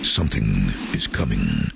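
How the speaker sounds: low, portentous trailer voiceover; film trailer voice. Shown as a demonstration. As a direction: announce it like a blockbuster trailer, low. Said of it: "And the first line was something".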